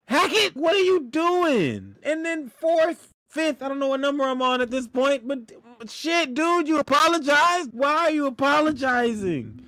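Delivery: high, incredulous yelling at the coach; disbelieving rant at Hackett; exasperated outburst. Real, not acted. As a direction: throw your hands up, voice way up. Venting at the head coach.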